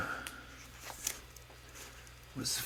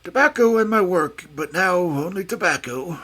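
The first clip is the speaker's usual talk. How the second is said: higher and louder, a bitter outcry; exclaimed lament; 4 semitones higher, 6 dB louder than usual. A narrator's reading. Old professor exclaims to Holmes over his loss, loud lament. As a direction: hit Tobacco hard, lamenting the loss.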